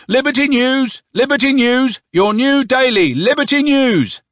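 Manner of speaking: high, loud street-seller's cry; ringing, stretched newsboy holler; newspaper boy's shout. A narrator's reading. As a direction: hawk the paper with drawn-out calls. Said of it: "shouted the newspaper boy".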